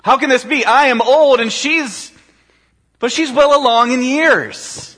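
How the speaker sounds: incredulous old priest, hammed up; mock incredulous Zechariah. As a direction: play up his disbelief. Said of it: "you can almost hear the pause in the text".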